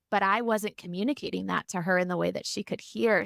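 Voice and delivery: ordinary talk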